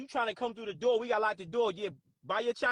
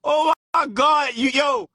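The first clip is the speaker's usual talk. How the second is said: loud, drawn-out exclamation; overwhelmed shout; 2 semitones higher, 13 dB louder, slower than usual. Real, not acted. Streamer overcome by internet antics, loud exclamation. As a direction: cry out loudly after laughing hard. Exclaiming after a hysterical laughing fit.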